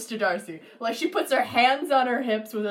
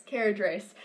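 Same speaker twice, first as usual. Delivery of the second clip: normal talking voice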